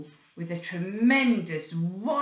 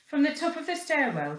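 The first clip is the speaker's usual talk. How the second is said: ordinary delivery, nothing marked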